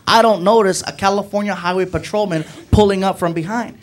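ordinary talk

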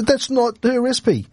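high-pitched, slightly slowed protest; indignant correction. Real, not acted. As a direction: put the co-host straight, pitched well up. Correcting co-host over recipe credit.

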